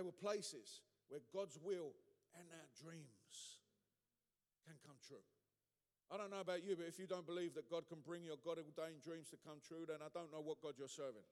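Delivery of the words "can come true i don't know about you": normal talking voice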